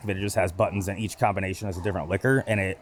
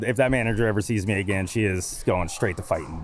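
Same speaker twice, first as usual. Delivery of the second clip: ordinary talk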